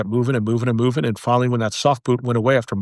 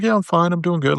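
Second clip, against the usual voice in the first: higher, breezy brush-off hiding the pain; telling a friend he's fine, falsely upbeat and higher; fake cheerful; 7 semitones higher than usual. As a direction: sound fine when you're not.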